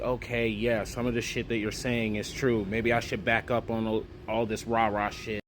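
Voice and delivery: low and grudging, giving in; grudging back-down. Retold or imitated. Ronald backing down to BK.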